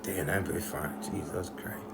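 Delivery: hushed, stunned murmur; under-breath awe. Retold or imitated. Boy gawking, muttering to himself in awe. Inner reaction whispered to self.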